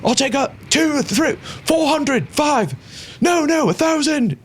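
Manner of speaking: high, rapid bidding calls; excited gambler voices. Acted. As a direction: shout bets like an eager crowd. Gamblers shouting bets.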